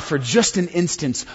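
usual speaking voice

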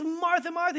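Martha, Martha called out high, as one urgent shout; calling out. Retold or imitated. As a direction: call her name to stop her in her tracks. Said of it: "a Hebrew way of shouting".

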